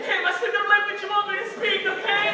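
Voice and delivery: fast, high, loud mock panic; hammed-up desperate surrender; panicked pleading. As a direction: beg frantically to appease a cop. Imagined himself pleading with cop.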